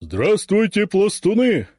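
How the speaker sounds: high, loud parade greeting; shouting drill sergeant. Acted. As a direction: call out to the whole company. Ataman greets the formation.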